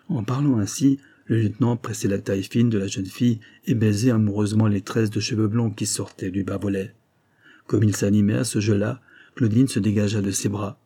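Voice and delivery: ordinary delivery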